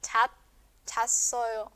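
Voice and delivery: "chat" as one closed syllable, then chasoyo; single-syllable contraction. Shown as a demonstration. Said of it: "una sola sílaba".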